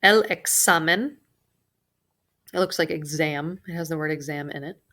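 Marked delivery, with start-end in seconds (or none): none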